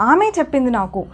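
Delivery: ఆమె said first and weighted, the rest falls away; stress on ఆమె. Shown as a demonstration. Teacher showing students the stress, leaning on ఆమె. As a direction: punch ఆమె, let చెప్పింది నాకు drop. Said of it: "నేను ఆమె పైన stress చేస్తున్నాను".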